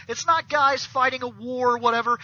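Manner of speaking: ordinary talk, nothing marked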